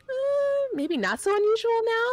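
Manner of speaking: drawn-in, high-pitched hesitant hedge; playful hedging. Real, not acted. Expert answering the host on how unusual it is, playfully hedging. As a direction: hedge it high and loud, conceding the point.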